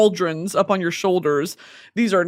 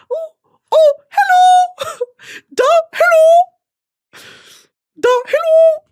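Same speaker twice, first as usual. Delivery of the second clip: high, loud, squealing flirty hollering; hollering in a forest; 7 dB louder than usual. Pretend. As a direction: holler at him like a lovestruck fan. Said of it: "Me to Jay Ellis".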